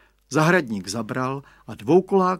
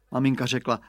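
usual speaking voice

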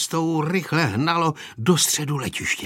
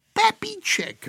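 put-on girl's cry; slower than usual. A narrator's reading. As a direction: cry it out high like a little girl. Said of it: "vykřikla Aneška". (dívčí výkřik)

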